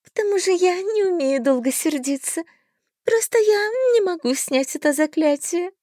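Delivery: high, whimpering fairy voice; a helpless little fairy; pixie. A narrator's reading. Fairy explains.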